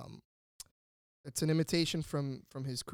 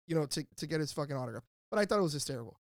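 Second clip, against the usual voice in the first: ordinary delivery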